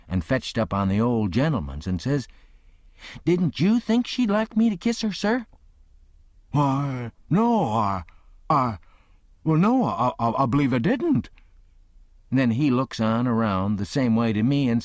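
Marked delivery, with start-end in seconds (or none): 3.2-5.5 high, wide-eyed plea; innocent appeal. A narrator's reading. Tom appealing to gentleman. 6.5-11.3 stammering, caught off guard; flustered old man. A narrator's reading.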